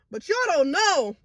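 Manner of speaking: high, upset voice; tearful. Real, not acted. Vlogger telling viewers they don't know, tearful. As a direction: plead through real tears. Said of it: "I got tears rolling down".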